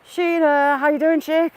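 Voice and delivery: high, loud, sing-song hello; bright greeting. Real, not acted. Greeting a regular viewer in the live chat, cheery and loud.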